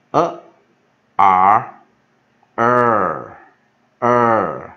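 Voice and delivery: loud "a", then "R", joined into a held "er"; sound blending demo. Shown as a demonstration. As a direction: blend "a" and "R" into "er" for learners. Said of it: "我们都会写".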